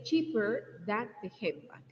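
careful teacher reading; slow model sentence. Shown as a demonstration. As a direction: spell it out slowly for the class. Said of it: "So we say".

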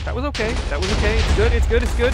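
high, loud, rapid repetition; frantic self-reassurance. Real, not acted. Player reassuring himself right after the explosion, frantic and loud. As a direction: rush it out loudly, insisting all is fine.

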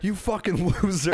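high scornful jeer with a laugh; mocking sneer. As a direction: jeer at the sellout, half laughing. Mocking a sellout musician.

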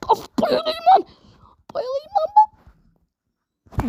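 high, whining mock faint, fading to a slow breathy whisper; mock swooning wail. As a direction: wail like you're about to pass out. Dying in game, fake swoon. (yüksek perdeden sahte bayılma inlemesi)

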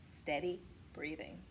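plain reading voice

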